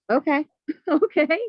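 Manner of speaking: laughing in disbelief. Retold or imitated. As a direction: chuckle through the okays. Amused reaction to big spending.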